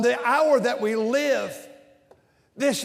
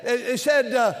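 ordinary talk, nothing marked

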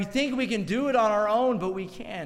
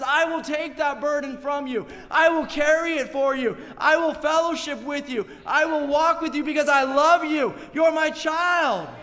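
building and louder, slowing into a loud final line; God the Father; 6 semitones higher, 4 dB louder than usual. Acted. Preacher speaks as the Heavenly Father to his child, building tender then loud.

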